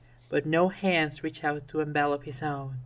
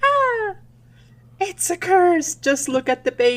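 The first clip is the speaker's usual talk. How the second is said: high, loud mock panic; hammed-up horror; 12 semitones higher, 5 dB louder than usual. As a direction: cry out as if the baby is cursed. Acted horror at cursed baby.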